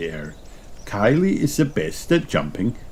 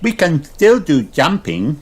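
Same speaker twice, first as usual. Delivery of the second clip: high eager piglet voice; bouncy upbeat girl; Peppa Pig; 9 semitones higher, 4 dB louder than usual. A narrator's reading. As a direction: Peppa rallying friends. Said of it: "says Peppa".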